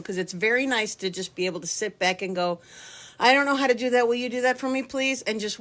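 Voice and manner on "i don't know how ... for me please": higher-pitched, hammed-up helplessness; playful helpless plea. Old-timer asking the young helper to do it, mock helpless plea. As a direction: wheedle for help in a put-on helpless voice. Acts out helpless request for help.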